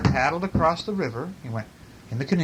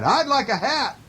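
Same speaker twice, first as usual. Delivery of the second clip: high storybook bear voice; calling bear; 10 semitones higher than usual. A narrator's reading. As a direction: call the answer out in a high voice. Bear calls his answer out.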